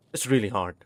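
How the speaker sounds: usual speaking voice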